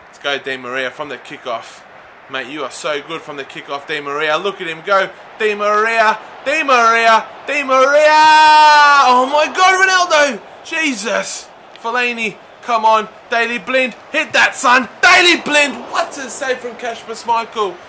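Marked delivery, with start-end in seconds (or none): 5.4-14.5 from raised to loud high shouting; building excitement. Real, not acted. Gamer following the attack, building excited shout. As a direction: climb with every pass.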